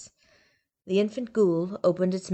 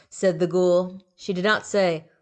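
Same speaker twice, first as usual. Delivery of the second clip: plain reading voice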